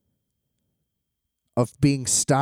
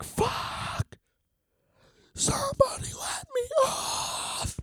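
hushed, high-pitched strained scream, drawn out; aggressive inner scream; 9 dB quieter, much slower than usual. Acted. Frustrated rider screaming in his head to be let off, aggressive hushed scream. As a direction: scream it under your breath, slow and desperate. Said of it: "it's, like, aggressive".

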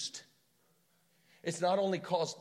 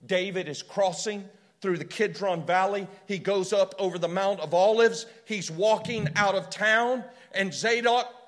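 rising, louder and higher, driving the story forward; preaching at full force; 4 semitones higher, 8 dB louder than usual. Acted. Preacher building to a peak telling of David's flight.

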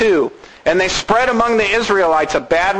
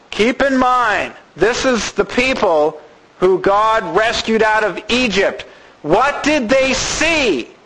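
usual speaking voice

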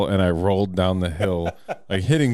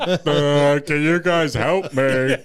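high, slow dopey cartoon voice; cartoon character voice; 8 semitones higher, 4 dB louder, much slower than usual. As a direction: play a goofy cartoon guy calling for help. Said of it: "he sounded like a cartoon character".

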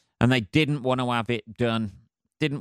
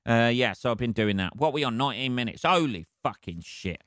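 ordinary talk, nothing marked

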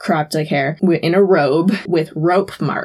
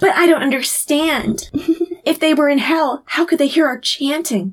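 lightly hammed-up puzzled actor, pitched up; actor reading lines; 7 semitones higher than usual. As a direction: deliver stage dialogue in mock bewilderment. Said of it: "stumbling through their new lines".

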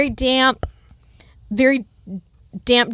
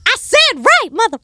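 rude GPS mom. Pretend. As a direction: bark directions like a cussing mom. Inventing rude mom nav voice.

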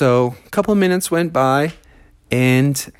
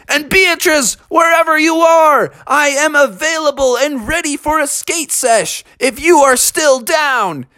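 high calling out; 11 semitones higher than usual. A narrator's reading. Froggy calling Beatrice over the microphone, high. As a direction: call out to her, high.

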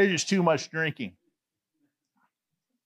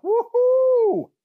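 high, loud celebratory "woo-hoo"; gleeful whoop; 7 dB louder than usual. Retold or imitated. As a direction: whoop with relief at being alone. Celebrates being alone in elevator.

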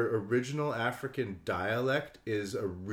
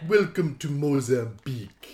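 slow, loud put-on African accent greeting; hammed-up African accent welcome; mock African accent; 6 dB louder than usual. To friend, joking about African accents, in a mock African accent.

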